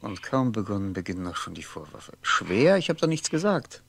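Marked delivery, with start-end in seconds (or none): 2.2-3.8 irritated retort. Acted. Irritated reply on the phone.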